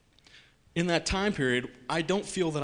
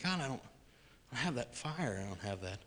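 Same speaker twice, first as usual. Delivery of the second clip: softening into a hushed admission; quiet confession; 3 semitones lower, 9 dB quieter than usual. Retold or imitated. Confessing to God, hushed and fading. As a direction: let it fade to almost nothing.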